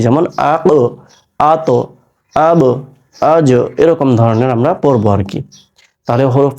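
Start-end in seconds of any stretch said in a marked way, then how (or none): 0.5-3.5 "আক, আত, আব, আজ" each with a down-and-up release on the last consonant; stop, then pop the consonant in "আক", "আত", "আব", "আজ"; separated drill syllables "আক, আত, আব, আজ" with echoed endings; bounced final consonants. Shown as a demonstration. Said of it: "ওঠানামা হবে আর কি".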